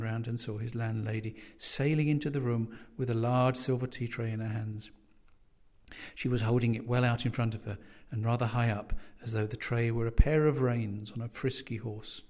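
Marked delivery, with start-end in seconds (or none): none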